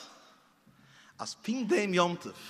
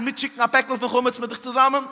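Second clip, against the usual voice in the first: mother questioning; 5 semitones higher, 6 dB louder than usual. Retold or imitated. Voiced mother questioning child.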